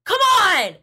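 frustrated yell at the television. Retold or imitated. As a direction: shout it like your team is losing. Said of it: "where I go".

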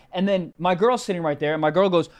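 normal talking voice

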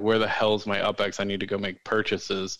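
ordinary delivery

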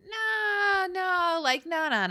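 high, rushed denial; brushing it off. Retold or imitated. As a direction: wave it away in a high voice. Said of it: "And Pacey's like".

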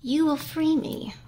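high, loud demanding witch. A narrator's reading. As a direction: command it like a witch freeing herself. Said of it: "the witch said".

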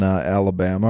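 usual speaking voice

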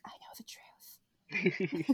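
slow, conspiratorial whisper; secretive whisper. Retold or imitated. Acting intern with a secret.

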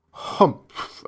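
breathy, unvoiced disdainful grunt; a lazy camel snorting contempt; grumpy camel humph.

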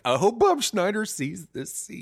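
loud, slow hammed-up desperate cry; mock Tom Hanks shout. Tom Hanks on the island, shouting in a loud, slow mock plea. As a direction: howl it like a castaway on the beach.

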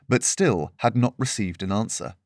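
plain reading voice

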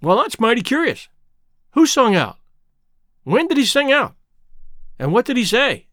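lightly puzzled, questions rising; mildly baffled, asking who sang out. A narrator's reading. Tom to the slave, mildly puzzled. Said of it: "in a puzzled up kinda way".

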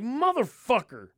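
slow, drawn-out mock outrage; exasperated outburst. Retold reaction to contaminated bag.

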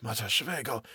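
very soft mumble; muttering to himself. A narrator's reading. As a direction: say it under your breath. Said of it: "Kurtz was muttering to himself".

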